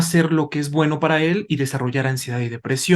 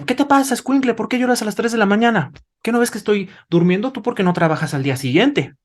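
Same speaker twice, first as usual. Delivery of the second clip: put-on higher-pitched, exasperated scolding of a crying baby; annoyed parent scolding; 7 semitones higher than usual. Parent woken at three a.m. scolds crying baby, exasperated and high. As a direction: snap at the kid like a tired, irritated parent. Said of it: "sería".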